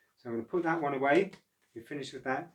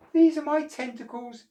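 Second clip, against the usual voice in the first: very high, louder proud creature voice; happy octopus; 8 dB louder than usual. Acted. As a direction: show off the tentacles gleefully. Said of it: "said the octopus happily".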